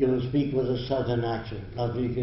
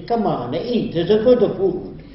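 mock Italian accent, higher and louder; imitated Italian accent; 6 semitones higher, 5 dB louder than usual. Lecturer showing how Italian upbringing shapes speech, mock Italian accent. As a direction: play an Italian urging someone to eat. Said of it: "if you're brought up in Italy".

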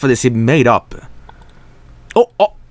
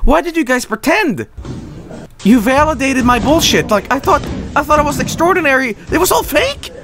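higher, pretend outraged teacher; mock scolding the students; 9 semitones higher than usual. Fake master scolds his students, higher and indignant. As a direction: throw his complaint back at the students, pitched up. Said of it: "be like".

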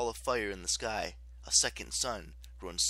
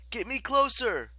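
high, raised-voice urging; urgent plea; 13 semitones higher, 7 dB louder than usual. A narrator's reading. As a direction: call out urgently over the noise. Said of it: "Piper urged".

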